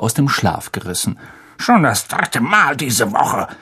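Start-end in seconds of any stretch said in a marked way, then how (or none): 1.6-3.5 mild blustering grumble; a touch of gruff rage, slowed; blustering uncle. Acted. Uncle grumbling over breakfast about the owl, blustering. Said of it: "polterte er über den Tisch hinweg".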